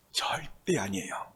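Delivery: hushed, slow whisper. Real, not acted. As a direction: drop to a whisper to press the point home. Preacher drops to a whisper. (조용히 속삭이며)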